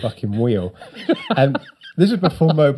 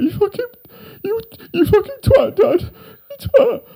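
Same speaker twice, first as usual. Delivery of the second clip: very high, louder, laughing squeal; giddy laughing; 3 dB louder than usual. Retold or imitated. Son thrilled at his dad's gift, laughing high squeal. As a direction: shriek the fond insult through laughter. Affectionate insult to dad.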